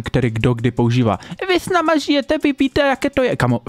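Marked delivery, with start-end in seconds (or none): 1.5-3.4 much higher mocking whiny voice; squeaky high parody of her line; mocking imitation. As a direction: parrot her argument back in a high sneer. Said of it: "Nejhorší argument".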